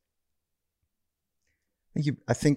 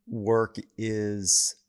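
usual speaking voice

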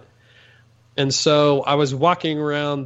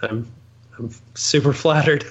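ordinary talk

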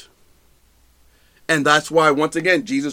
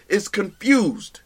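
ordinary talk

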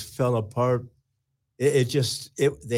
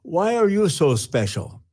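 raised, louder stern rebuke; voice of God; 4 semitones higher, 3 dB louder than usual. Retold or imitated. God rebuking a self-pitying pastor, raised and stern. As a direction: thunder it back as God. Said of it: "He said".

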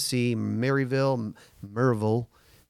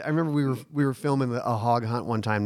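usual speaking voice

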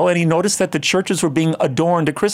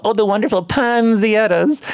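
higher-pitched mock old-lady gush; gushing old ladies; 6 semitones higher than usual. Mimicking old ladies who make the guest giggle, higher mock gushing. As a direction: imitate old ladies mangling "pansiettas". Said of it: "some old ladies call".

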